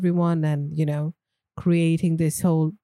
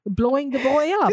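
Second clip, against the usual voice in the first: laughing; 6 semitones higher than usual. Real, not acted. Laughing at the drone idea.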